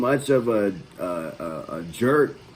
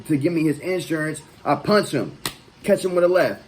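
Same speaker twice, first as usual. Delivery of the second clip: usual speaking voice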